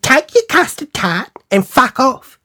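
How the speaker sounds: high, scornful dismissal; mock indignant send-off; imitated angry friend. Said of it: "she was talking to me like that".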